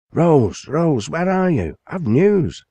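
high, loud call through the house; father bursting in with news, calling loudly. A narrator's reading. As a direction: call for her from the hallway, eager.

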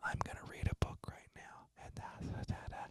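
hushed whispered streamer voice; mock ASMR whisper into the mic; imitating ASMR whisper. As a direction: whisper it softly like an ASMR girl reading to the mic.

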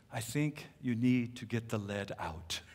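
low, soft, deliberate rebuke; Pastor Vincent. Retold or imitated. Shorter pastor stepping up to the excuse-maker, low quiet rebuke. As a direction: imitate a quietly confronting pastor.

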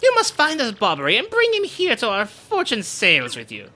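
pompous, theatrical ship-proud boasting; boastful Flavio. Acted. To Mario, pompous boasting about the ship. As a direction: show off the ship grandly.

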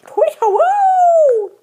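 high, slow hooting owl call, with a laugh; owl hoot imitation.

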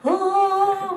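a high, sudden "oh" of realisation; surprised oh. Retold or imitated. Psychiatrist realising during the interview, high surprised oh. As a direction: gasp into a high "oh" as it dawns on you.

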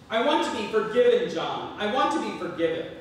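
loud desperate scream. Acted. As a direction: scream it like a wailing mother. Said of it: "and she screamed".

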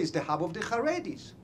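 ordinary delivery

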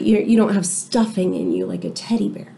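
plain reading voice

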